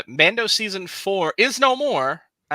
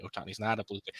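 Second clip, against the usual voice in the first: much softer, lower take-back; mock deflated retraction; 5 semitones lower, 10 dB quieter, faster than usual. Replaying Saturday's retracted rumor to co-hosts, mock deflated retraction. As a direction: drop it away like a let-down correction.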